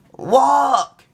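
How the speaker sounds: put-on high, loud child command; proud small boy giving an order; little boy command. A narrator's reading. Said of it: "he said".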